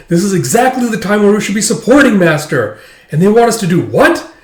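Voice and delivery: high-pitched, emphatic complaint; indignant protest. Acted. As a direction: act out an outraged objection. Acting out Guriko's protest.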